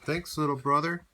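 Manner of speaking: big truck's loud grateful thanks. Acted. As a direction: beam out thanks to a little brother. Dump truck thanks Blue.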